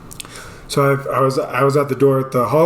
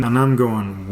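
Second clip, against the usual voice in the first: ordinary talk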